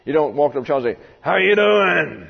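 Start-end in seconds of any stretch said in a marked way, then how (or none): 1.2-2.3 louder, slow, booming how you doing; scary greeting. Shown as a demonstration. Preacher shows the greeting that scares children, loud and slow. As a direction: loom over the kid with a big slow greeting. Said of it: "They run".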